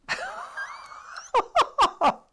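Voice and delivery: high shrieking laugh; real high laugh. Real, not acted. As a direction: burst out laughing, squealing. Reacting to dad's comment.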